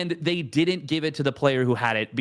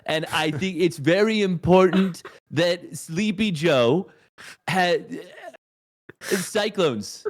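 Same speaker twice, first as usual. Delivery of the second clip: Trump impression; 3 semitones higher, 3 dB louder than usual.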